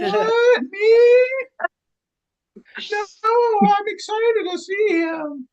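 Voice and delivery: mock high voice. Mock denial about Mohawk Mike.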